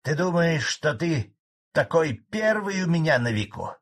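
громче, злой старик переходит на ты; выпали злобно; от злости. A narrator's reading. (старик в злости тыкает председателю)

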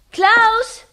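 loud, high call through the house; calling out anxiously. Acted. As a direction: call for him, alarmed. Woman calls for missing husband.

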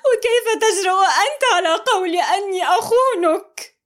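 high, loud, tearful cry. A narrator's reading. Amal crying at Mohamed in front of her family. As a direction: sob out the reproach. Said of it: "تقول باكية".

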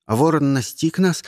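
ordinary delivery

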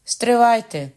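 loud, high cry to stop; shouted. Acted. Governess shouts at the crowd to stop, loud. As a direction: shout to hold them back. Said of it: "кричала за моїх плеч мадмуазель".

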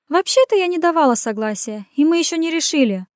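high, flustered protest; protesting babble. Acted. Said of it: "протестующе залепетала Иренка".

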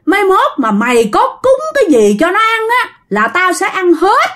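high-pitched, indignant threats; acted outrage of a vengeful spirit; threatening spirit. A narrator's reading. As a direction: pitch it high and menace the family. Enraged spirit threatens the family.

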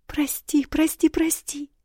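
put-on high, tearful pleading girl; wailing princess. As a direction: beg forgiveness in a high wail. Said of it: "запричитала княжна Велопольская". (причитающая княжна высоким голосом)

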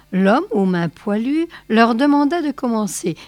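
usual speaking voice

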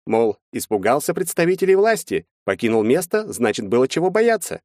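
high-pitched, animated lawyer; lawyer character. A narrator's reading. Lawyer laying out the defense to his clients, high animated lawyer voice. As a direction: voice a lawyer reasoning in high, lively tones.

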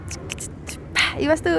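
normal talking voice

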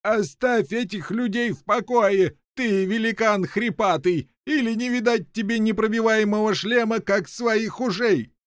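high, scolding call; shouting prisoner. A narrator's reading. Inventor threatening the giant from his cell, high scolding. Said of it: "крикнул также, приходя им на помощь".

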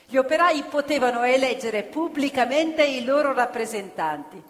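ordinary talk, nothing marked

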